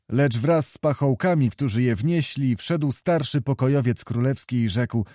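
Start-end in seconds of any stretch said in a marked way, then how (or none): none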